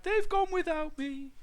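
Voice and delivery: high-pitched mild wail; plaintive little dinosaur; crying out. A narrator's reading. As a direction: cry it out as a left-behind child. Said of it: "he cried".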